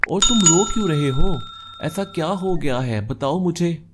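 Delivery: concerned urging. Acted. Nikhil asking his crying friend what happened, urgently concerned. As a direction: plead for an answer with rising urgency.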